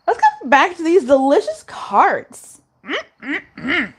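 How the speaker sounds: high, slow sing-song savoring; playful relish. Real, not acted. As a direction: savor the cards like dessert. Relishing the tarot cards.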